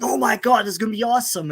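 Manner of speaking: put-on retelling his young hype, gushing; imitated excited fan.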